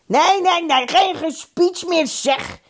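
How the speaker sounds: high-pitched heckle; shouted protest. A narrator's reading. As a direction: shout down the speaker. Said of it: "schreeuwde Dolf Hovey".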